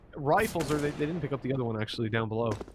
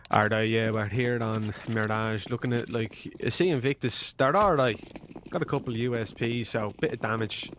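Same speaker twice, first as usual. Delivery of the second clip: a lower, lightly put-on Irish caster accent; mock a fellow caster's inner-city Irish delivery, a touch lower; imitating Dean; 5 semitones lower than usual. Co-caster teasing with an impression of another caster, mild Irish accent. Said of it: "Okay, Dean".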